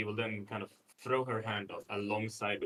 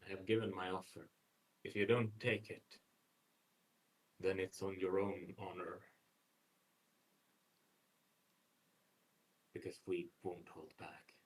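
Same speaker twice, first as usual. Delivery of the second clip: lightly voiced noble, measured and formal; resolute noble warrior; 3 semitones lower, 8 dB quieter than usual. Noble giving the Huntress his final word, quietly resolute. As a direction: state your final offer evenly, dropping soft at the end. Player speaks as noble character.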